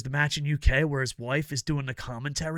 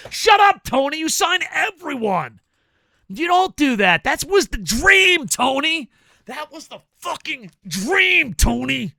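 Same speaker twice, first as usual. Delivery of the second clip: loud, high, furious yelling at Tony; angry shout; 10 semitones higher, 6 dB louder than usual. Acted. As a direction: let him have it, full-throated rage. Angry rant at absent Tony.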